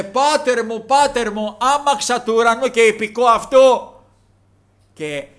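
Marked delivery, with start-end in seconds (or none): 0.0-3.9 loud, high anguished call to a departing father; crying out. Retold or imitated. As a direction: call after him, louder and louder. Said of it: "και φώναζε". (δυνατή κραυγή προς τον πατέρα)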